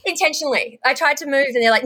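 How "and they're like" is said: ordinary talk, nothing marked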